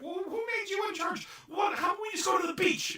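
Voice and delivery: high, loud mock outrage; hammed-up indignant yell; indignant shout. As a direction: yell it as a comic protest. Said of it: "It's like".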